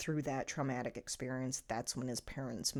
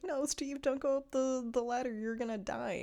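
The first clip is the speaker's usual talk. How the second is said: panicked Dustin; 9 semitones higher, 4 dB louder than usual. Retold or imitated. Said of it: "where Dustin's like".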